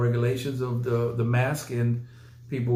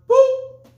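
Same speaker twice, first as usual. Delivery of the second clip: a sudden squeaky high "Boop" as a button click; high boop sound effect; 10 dB louder than usual. Pretend. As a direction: pop out a tiny squeaky boop like a button press. Mimicking a share button click.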